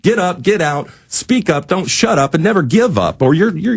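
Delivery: ordinary talk, nothing marked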